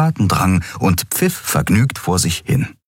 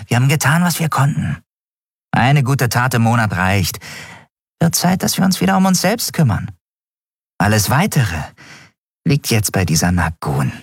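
high-pitched creature voice, dismissive; grumbling Skria; 6 semitones higher than usual. Acted. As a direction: voice a growly creature brushing the matter off.

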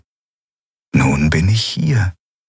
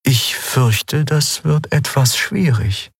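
lightly voiced, unhurried spirit; measured spirit; 6 semitones higher, slower than usual. A narrator's reading. The Eidolon gently declines a proposal, slow and measured. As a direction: let the words come out slowly and carefully.

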